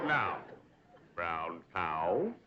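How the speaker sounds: "brown cow" with rounded, drawn-out vowels; diction drill. Shown as a demonstration. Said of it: "practice diction and intonation".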